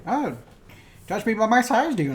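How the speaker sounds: a light over-the-top Yoda; amped-up Yoda. Retold or imitated. Podcaster mocking the exaggerated movie Yoda, lightly hammed-up. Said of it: "turn that shit up to eleven".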